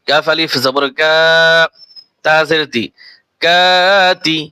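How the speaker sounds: ordinary talk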